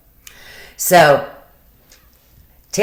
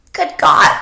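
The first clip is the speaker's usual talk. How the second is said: incredulous laughing exclaim; 10 semitones higher, 7 dB louder than usual. Real, not acted. Laughing in disbelief.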